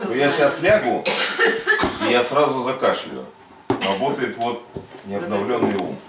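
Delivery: normal talking voice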